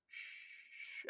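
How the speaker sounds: unvoiced soft "shh"; hushing sound. Soldiers silently slinking into the bushes, hushing. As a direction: hush like someone slipping away.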